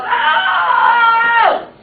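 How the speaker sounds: long, high, loud howl of pain; father's big hurt yell. A narrator's reading. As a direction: holler out a drawn-out ow. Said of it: "And he yelled".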